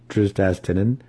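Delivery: ordinary delivery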